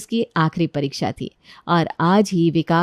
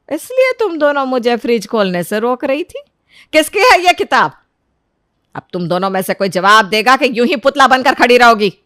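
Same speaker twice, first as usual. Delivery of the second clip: loud, raised-pitch interrogation; stern mother-in-law, angry; 4 semitones higher, 7 dB louder than usual. Acted. Mother-in-law finds the hidden book, loud angry interrogation. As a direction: demand an answer angrily.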